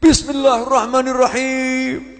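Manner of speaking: high, slow shouted bismillah; chili-burned husband wailing bismillah; drawn-out cry. Retold or imitated. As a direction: wail it out with a burning mouth. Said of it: "dia teriak".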